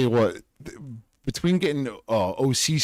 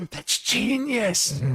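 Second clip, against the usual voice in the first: delighted; 12 semitones higher, much slower than usual. Real, not acted. Gasps at his own idea.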